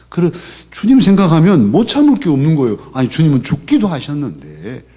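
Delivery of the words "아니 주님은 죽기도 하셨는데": normal talking voice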